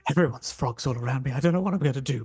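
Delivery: hurried reporter's confession to camera; lightly acted Blair Witch style panic; rushed, mildly frantic reporter; panicked found-footage reporter. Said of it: "reporter who's Blair Witch projecting".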